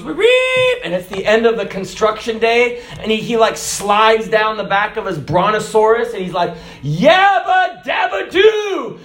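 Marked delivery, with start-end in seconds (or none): 0.0-0.8 high squawked end-of-shift signal; imitated cartoon bird whistle. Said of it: "the dinosaur or the bird goes". 6.8-9.0 high, drawn-out cartoon cheer; Fred Flintstone. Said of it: "so when Fred Flintstone".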